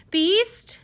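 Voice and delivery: high loud anguished call; cried out. Retold or imitated. Beauty finding the Beast lying still, crying out high. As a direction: call out to him in alarm. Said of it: "Beauty cried out".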